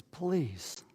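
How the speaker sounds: barely audible, pleading please; a tired man's hushed please; quiet plea. Retold or imitated. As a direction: beg softly, worn out and desperate. Innkeeper repeats husband's plea.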